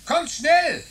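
high excited call across a distance. Acted. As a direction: call them over, urgent. Boy calling friends from outside.

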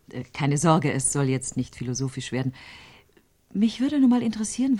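ordinary talk

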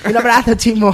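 un grito alegre y agudo, más fuerte; saludo gritado. Real, not acted. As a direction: lanza el abrazo a Chimo a voces. (un saludo a gritos, entre risas)

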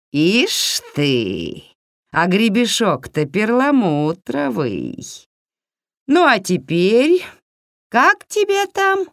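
slow witchy drawl, climbing very high; drawn-out, sing-song hag voice; gloating witch. A narrator's reading. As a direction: savour it like a greedy old witch. Yaga gloats over her gifts.

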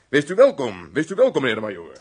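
loud, swooping greeting; effusive welcome. Acted. Butler receives the major at the castle, effusive welcome. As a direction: greet the guest grandly, quickening on the repeat.

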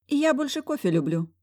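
high squeaky little voice; squeaking. A narrator's reading. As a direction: squeak it out timidly. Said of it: "пискнуло это странное существо".

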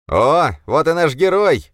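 high, loud, drawn-out taunt; squeaky mocking shout. A narrator's reading. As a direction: squeal it out slowly to jeer at him. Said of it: "крикнул какой-то писклявый голос".

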